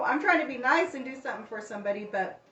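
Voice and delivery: ordinary delivery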